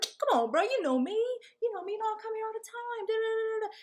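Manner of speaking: high, fast, insistent bro voice; pleading bro. Retold or imitated. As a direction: talk your way past the door. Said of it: "He like".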